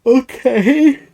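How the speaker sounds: disbelieving okay, then burst out laughing; incredulous okay. Real, not acted. Streamer reacting to the stolen terminal, incredulous laugh.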